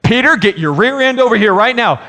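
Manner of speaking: put-on the last-warning call to a dawdling kid; stern parent. Parent ordering Peter over right now, fed up.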